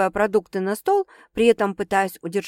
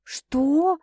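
a mild higher startled what; surprised; 7 semitones higher than usual. A narrator's reading. Sasha lightly surprised by her sister's news. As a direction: caught off guard, cut short. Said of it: "удивлённо осеклась".

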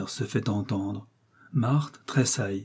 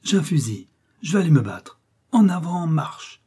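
put-on high, louder boyish excitement building to a command; proud joy; 6 semitones higher, 4 dB louder than usual. A narrator's reading. Boy with a gun off to fight, joyous and martial. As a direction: crow it, then order the charge. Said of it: "avec un accent de joie superbe".

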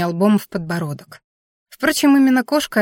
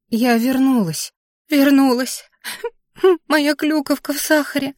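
understated tearful little creature voice, higher at the end; sobbing; 6 semitones higher than usual. A narrator's reading. Anfisa to the returned heroine, sobbing lightly. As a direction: whimper it with a small catch of breath. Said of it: "всхлипнула зверушка".

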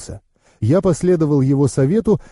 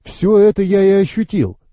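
higher, eager cut-in; interrupting; 7 semitones higher than usual. A narrator's reading. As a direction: break in on the other speaker. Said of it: "перебил я Генрия".

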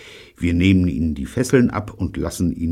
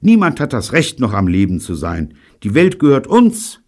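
high, loud outburst; rousing, raised-voice claim; indignant proclamation; 5 semitones higher, 6 dB louder than usual. Acted. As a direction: proclaim it defiantly. Betty's indignant, stirring claim.

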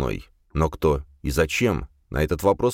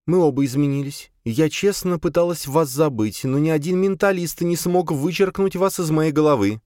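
raised pitch female voice; female character voice; 11 semitones higher than usual. A narrator's reading. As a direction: voice Sofia higher. Sofia voiced by male narrator.

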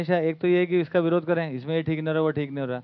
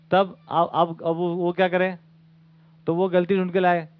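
ordinary delivery

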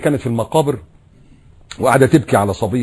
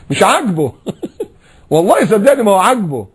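laughing; 8 semitones higher, 4 dB louder than usual. Real, not acted. Laughing about the grumbler.

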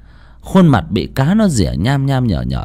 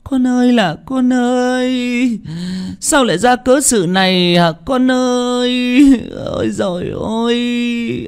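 wailing in grief; 13 semitones higher than usual. Acted. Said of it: "miệng gào khóc". (gào khóc than con, kéo dài giọng)

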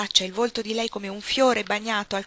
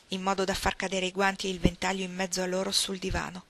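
plain reading voice

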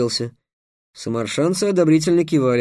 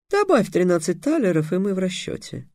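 high start, softening close; smiling offer; 5 semitones higher than usual. A narrator's reading. Slave trader closing the deal with Trix, smiling. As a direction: offer it with an audible smile. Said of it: "улыбнулся работорговец".

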